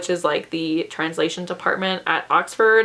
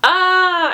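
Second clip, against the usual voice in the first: high teary laugh then a gasp; emotional laugh; 11 semitones higher, 4 dB louder than usual. Real, not acted. Recalling a sad week, a high teary laugh. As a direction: laugh through rising tears. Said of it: "I get so emotional".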